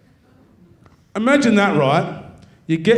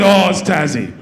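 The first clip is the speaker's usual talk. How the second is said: playing the defensive local on the bridge; Tassie local; 2 semitones higher, 5 dB louder than usual. Comedian mocks the bridge guy's gruff reply, loud mock bloke voice. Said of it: "He goes".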